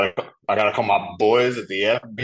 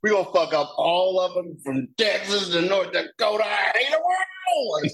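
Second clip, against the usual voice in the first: raised, ranting hammed-up thug; mock enraged villain; 8 semitones higher than usual. Son turns villain after dad dies, hammed-up rant. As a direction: rant it like a cartoon bigot. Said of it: "the switch gets flipped".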